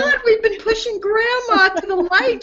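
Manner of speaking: laughing alarm. Retold or imitated. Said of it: "they were all like".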